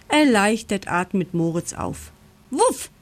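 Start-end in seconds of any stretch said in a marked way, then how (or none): 2.5-2.9 short, high-pitched woof; one quick high imitated bark; dog's bark. A narrator's reading. Farm dog barks suddenly near the mole, high woof. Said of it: "bellt es in dem Moment".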